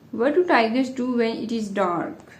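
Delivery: normal talking voice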